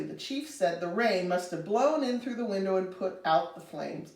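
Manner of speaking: usual speaking voice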